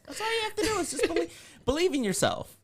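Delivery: high laughing voice; laughing mock pep talk. Real, not acted. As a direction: laugh while saying it. Laughing through mock advice.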